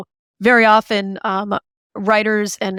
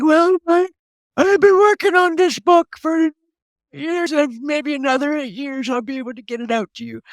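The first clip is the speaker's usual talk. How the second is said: put-on higher, creaky elderly voice; old person voice; 6 semitones higher than usual. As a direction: talk like a tired old-timer. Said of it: "that was my old person voice".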